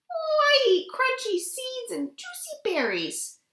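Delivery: a small mouse chirping about her food; little mouse voice. Acted. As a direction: pipe it up like a tiny mouse. Mouse answers the frog.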